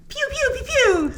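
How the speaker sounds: playful high pew-pew gun noises; mock gunshots. Viewer joining the onscreen shootout, playful high pew-pew noises. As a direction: make toy-gun sounds like a kid.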